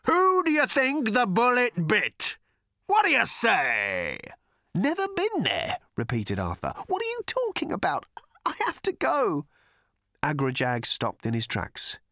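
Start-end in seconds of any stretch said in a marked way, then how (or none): 0.1-4.2 slow, high indignant monster; accusing creature voice. Acted. Agrajag accusing. 4.8-9.4 baffled Arthur voice. Acted.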